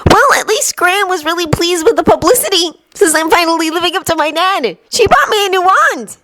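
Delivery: put-on high, proud boy's voice; pleased and proud boy; Neville voice. A narrator's reading.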